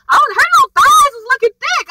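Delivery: loud, high, thrilled outburst; excited shout. Real, not acted. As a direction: yell it out, hyped up. Gushing over a character's outfit.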